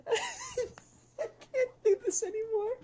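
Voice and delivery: slow, high, broken by laughing sobs; can barely get it out for laughing; crying with laughter. Real, not acted. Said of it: "I'm crying".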